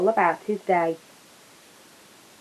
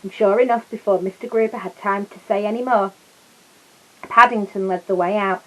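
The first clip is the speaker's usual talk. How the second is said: ordinary delivery, nothing marked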